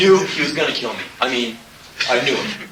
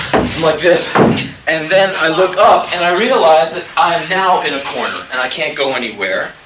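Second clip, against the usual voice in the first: ordinary talk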